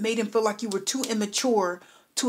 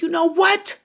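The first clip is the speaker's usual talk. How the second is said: higher, louder, indignant snap; a hammed-up "you know what" anger; mock angry outburst; 7 semitones higher, 5 dB louder than usual. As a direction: play the fed-up client losing patience. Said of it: "I am angry with you".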